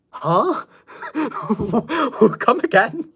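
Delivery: high, laughing "come again?"; incredulous giggling question; laughing disbelief. Retold or imitated. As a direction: laugh it off in total confusion. Baffled reply to weird text.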